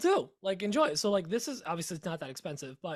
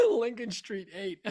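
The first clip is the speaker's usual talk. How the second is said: laughing; 3 semitones higher, 4 dB louder, slower than usual. Real, not acted. Laughing repeat of joke.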